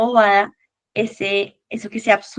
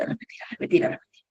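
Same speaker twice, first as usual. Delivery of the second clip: fading to a whisper; 7 dB quieter, faster than usual. Shown as a demonstration. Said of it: "empiezo a los cuarenta y cinco segundos a repetir".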